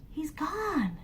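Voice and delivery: hushed whispering. Acted. Townspeople peeking out at the empty field, whispered awe. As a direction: whisper in amazement. Hushed town after giant vanishes.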